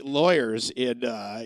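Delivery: ordinary delivery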